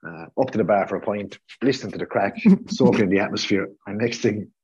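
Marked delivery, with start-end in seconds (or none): none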